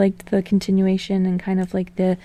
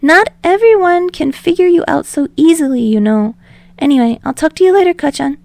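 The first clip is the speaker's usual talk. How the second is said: higher, louder eager Deku voice; rambling; 8 semitones higher, 5 dB louder than usual. Acted. Deku rambling to Bakugo on the phone, higher and eager. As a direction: babble on nervously without a breath.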